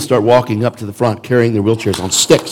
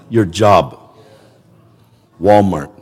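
ordinary delivery

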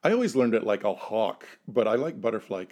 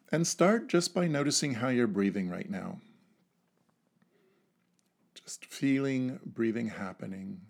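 guided meditation. Acted. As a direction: lead them gently into calm breathing. Leads a breathing exercise.

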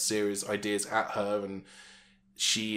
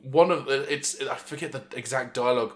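ordinary talk

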